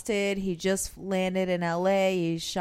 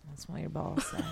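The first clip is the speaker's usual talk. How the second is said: whispered mock romance; 3 semitones lower, 9 dB quieter than usual.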